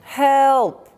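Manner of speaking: loud, high cries for help; desperate shouting. Acted. Pilgrim hanging from a cliff shouts for help, loud high desperate cries. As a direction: yell for help into the empty valley. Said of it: "and he's shouting".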